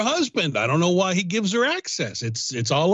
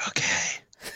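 hushed whispered reply; soft whisper with no voice; 7 dB quieter than usual. Real, not acted. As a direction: whisper it back. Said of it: "We whispering".

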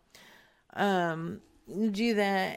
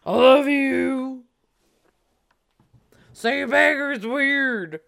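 Mister Biggs; 3 semitones higher, 6 dB louder than usual. Pretend. Demanding love from the caller, loud mock manly. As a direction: declare love as a pretend man. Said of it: "This is Mister Biggs".

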